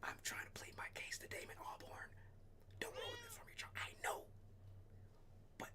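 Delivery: unvoiced hushed whisper. Real, not acted. Telling John not to ruin it, whispering.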